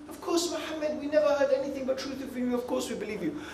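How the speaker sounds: soft, quick reassurance; crowd's assent. Acted. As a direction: answer quickly and softly, as the trusting people. Voices the people answering the Prophet.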